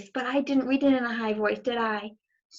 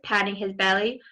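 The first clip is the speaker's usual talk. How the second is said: ordinary delivery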